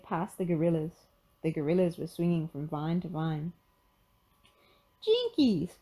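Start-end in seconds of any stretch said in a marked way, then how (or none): 5.0-5.8 high startled exclamation; high-pitched cry of surprise; Velma crying out. Acted. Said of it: "cried Velma".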